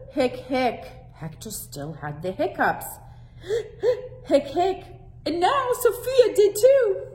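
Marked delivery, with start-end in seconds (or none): 0.1-0.8 hiccup gasps. Acted. Hector still hiccuping. 4.2-4.8 hiccup gasps. Acted. Sofia catches hiccups.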